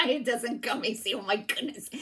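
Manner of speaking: real laugh through the words. Real, not acted. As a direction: laugh at yourself while talking. Laughing at her own word slip.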